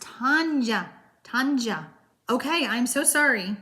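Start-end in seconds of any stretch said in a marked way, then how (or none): 0.0-1.9 "Tanya" sounded out slowly as TAHN-yah; careful name pronunciation. Shown as a demonstration. Host practising a viewer's name, careful "Tanya" as TAHN-yah. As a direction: say "Tanya" with an open "ah" in the first syllable. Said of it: "your name is pronounced".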